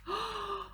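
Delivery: sharp gasp of wonder. A narrator's reading. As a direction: breathe in sharply, amazed. Storyteller gasps in wonder.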